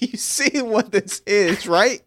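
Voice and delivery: squeaky high, slow disbelief; incredulous mock voice. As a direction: pitch way up like you can't believe this. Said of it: "They're like".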